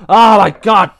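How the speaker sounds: loud shaken exclamation; real fright. Real, not acted. Gamer recovering from a jump scare, frightened. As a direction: gasp it out after a scare. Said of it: "Why did that scare me so much?".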